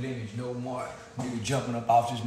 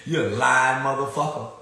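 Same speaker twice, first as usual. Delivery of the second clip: ordinary delivery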